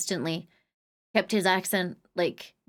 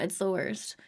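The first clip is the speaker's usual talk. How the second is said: ordinary talk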